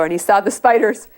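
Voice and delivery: usual speaking voice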